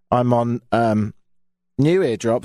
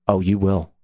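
ominous doctor; 8 semitones lower than usual. Retold or imitated. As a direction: do a doctor who knows it will hurt. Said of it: "she looked at me and she said".

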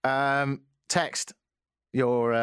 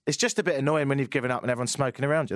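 ordinary delivery

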